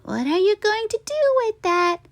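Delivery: put-on high wide-eyed girl voice; curious little girl; little sister. A narrator's reading. Sister asks Stuart about the carrot, high and wide-eyed. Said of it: "asked his wide-eyed sister".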